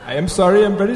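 loud apology in an Indian accent. Shown as a demonstration. Pupil apologising to the examiner, Indian accent. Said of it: "repeat after me in your best Indian accent".

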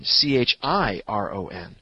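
slow, letter by letter; spelling out. Shown as a demonstration. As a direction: spell it out slowly for the listener. Spelling out the name.

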